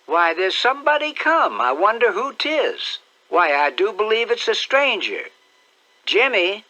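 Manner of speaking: put-on high, bright-eyed old aunt calling out; a fussy country aunt, curious and excited; high-pitched eager aunt peering out the window; Aunt Sally. Aunt Sally spots a stranger.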